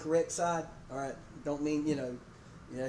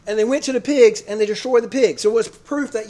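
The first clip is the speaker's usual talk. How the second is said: loud, forceful preaching; booming pulpit emphasis; emphatic preaching; 3 semitones higher, 11 dB louder than usual. Real, not acted. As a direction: raise the voice to drive the point home. Preacher driving home the point.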